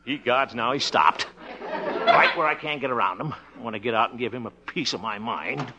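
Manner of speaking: ordinary delivery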